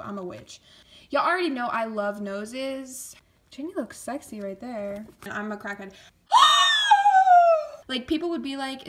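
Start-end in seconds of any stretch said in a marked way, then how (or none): 6.3-7.8 loud, long high squeal; high gasping shriek. Real, not acted. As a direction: gasp into a piercing fangirl scream. Sudden shock at the merchandise.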